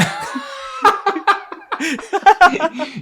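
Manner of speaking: high-pitched belly laugh; cracking up loudly; loud burst of laughter. Real, not acted. Laughing at own story.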